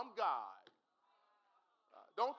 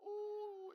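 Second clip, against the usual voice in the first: emotional cry; 10 semitones higher, 6 dB quieter than usual. Real, not acted.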